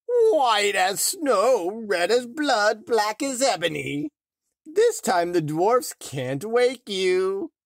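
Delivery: high, loud, slow triumphant gloat; gloating cry. A narrator's reading. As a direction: relish every word of the curse. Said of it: "laughed out loud, and cried".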